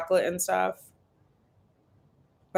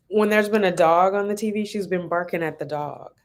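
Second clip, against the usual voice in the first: ordinary talk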